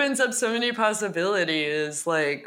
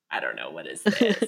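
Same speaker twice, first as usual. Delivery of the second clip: deep creaky vocal fry, much lower; faster than usual. Pretend. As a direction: let the voice crackle way down low. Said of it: "Ultimate vocal fry".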